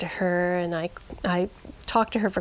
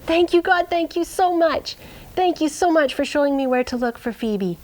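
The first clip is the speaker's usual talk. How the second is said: joyful thanks; 7 semitones higher than usual. Retold or imitated. Owner thanking God after finding the sheep, joyful. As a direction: pour out grateful relief.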